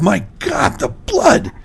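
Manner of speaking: rising to a high horrified cry. Acted. Husband finding blood, horrified high cry. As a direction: gasp it out in horror.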